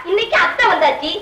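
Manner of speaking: usual speaking voice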